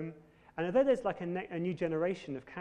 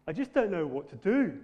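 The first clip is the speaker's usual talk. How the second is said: louder mock Cockney, "don't" leaning into the Cockney vowels; a hammed-up EastEnders Cockney line; Cockney accent; 3 semitones higher, 10 dB louder than usual. Preacher imitating EastEnders characters in a mock Cockney accent. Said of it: "that's kind of Cockney".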